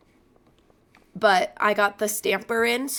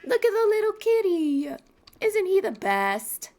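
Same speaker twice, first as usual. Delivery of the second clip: high, louder cooing, slowing down; 11 semitones higher, 3 dB louder than usual. Real, not acted. Cooing at her cat.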